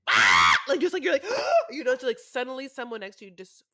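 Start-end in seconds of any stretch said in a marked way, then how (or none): 0.1-0.5 loud sudden imitated scream; sudden fake scream in the dark; imitated night terror scream; mock scream. 1.2-1.7 startled gasp. Retold or imitated. Imitates waking startled.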